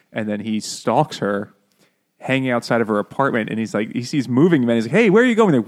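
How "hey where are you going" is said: high loud call. Retold or imitated. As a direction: call out after them. Franz calling out to movers.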